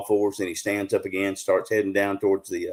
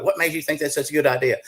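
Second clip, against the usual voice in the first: scolding wife; 6 semitones higher than usual. Retold or imitated. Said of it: "I'm getting an earful".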